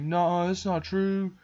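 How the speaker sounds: high, slow, touched disbelief; hammed-up tearful softening, drawn out; mock teary-eyed. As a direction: imitate a boy welling up. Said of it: "get teary-eyed".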